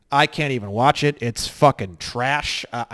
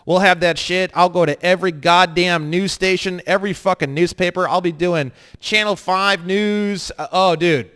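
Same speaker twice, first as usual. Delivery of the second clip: usual speaking voice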